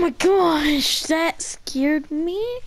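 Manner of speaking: slow, loud startled gasp of words. Real, not acted. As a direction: react as if just startled. Startled by game event.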